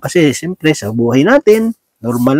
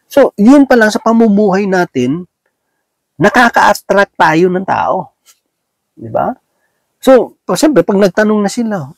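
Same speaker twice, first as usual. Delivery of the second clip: ordinary talk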